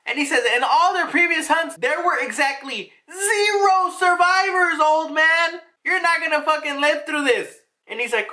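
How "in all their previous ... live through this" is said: high, emphatic scolding; exasperated scolding. Acted. Ivankov telling an old man he has no chance, exasperated. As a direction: hammer the point home, pitched high and exasperated.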